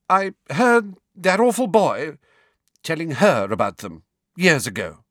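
high, loud, halting confession; jerky. A narrator's reading. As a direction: get it out in jerks. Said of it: "she said jerkily".

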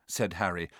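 ordinary delivery, nothing marked

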